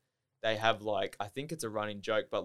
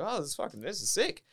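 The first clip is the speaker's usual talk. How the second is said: higher-pitched retold thrill; retold excitement; 8 semitones higher than usual. Telling how he reacted to the after party, excited. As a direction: replay being thrilled, pitched up.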